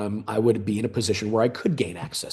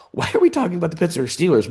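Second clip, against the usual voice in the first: higher, quicker baffled query; puzzled colleagues; 7 semitones higher, 3 dB louder, faster than usual. Retold or imitated. Imagining colleagues questioning the football talk, puzzled. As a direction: voice their puzzlement in a raised pitch.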